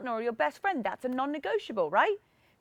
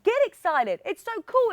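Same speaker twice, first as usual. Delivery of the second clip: pumped up; 7 semitones higher, 6 dB louder than usual. Real, not acted. Coach pumping up viewers.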